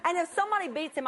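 ordinary delivery

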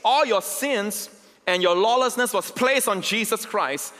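high, slow, building sermon voice; fervent preaching. Acted. Pastor pressing the cross on his congregation, fervent preaching. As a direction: preach it to the back row.

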